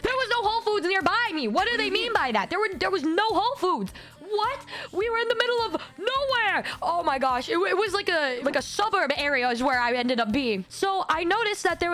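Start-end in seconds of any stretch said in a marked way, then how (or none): 0.0-6.6 high-pitched, incredulous protest; exasperated outburst. Real, not acted. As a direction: protest in disbelief, pitch leaping up. Outraged at being sent off.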